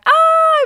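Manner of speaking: sudden loud, high-pitched squeal. Retold or imitated. Mum and daughter squeal when rain starts. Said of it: "And we were like".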